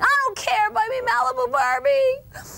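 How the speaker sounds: put-on screaming child demanding a Barbie; kid screaming. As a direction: shriek it like a toddler mid-tantrum. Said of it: "Should the kid respond by screaming".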